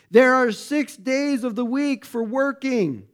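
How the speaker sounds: indignant. Acted. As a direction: scold the crowd like an offended official. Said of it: "was indignant".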